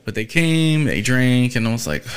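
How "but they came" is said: ordinary delivery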